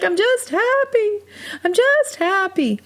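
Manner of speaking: high, slow, crying voice; happy tears. Retold or imitated. She answers worried churchgoers, slow high happy sobbing. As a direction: squeeze the words out through tears of joy.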